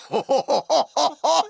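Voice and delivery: high, rippling villainess laugh. Acted. Sea witch about to offer a deal, high gloating laugh. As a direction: laugh high in a string of quick hoots.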